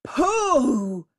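loud scornful pooh; scornful interruption. Acted. The tiger cuts in on the jackal, scornful. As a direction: snort it with contempt. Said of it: "interrupted the tiger".

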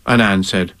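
usual speaking voice

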